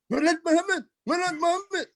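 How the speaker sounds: high, eager voice repeating the name; lightly voiced admirer from Israel; imitating a foreign admirer. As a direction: play an excited foreign fan. Voicing Israelis greeting him.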